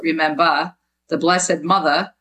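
"remember" and "Mother" end in -a, r dropped; Jersey pronunciation. Shown as a demonstration. Author quoting her chapter title, "Mother" as Jersey MUTH-a. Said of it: "M U T H A".

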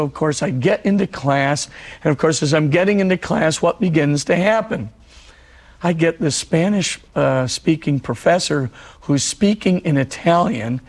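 usual speaking voice

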